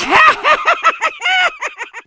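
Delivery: a high, long burst of laughter; hearty laugh. Acted. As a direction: laugh at your own joke. Mocking laugh mid rant.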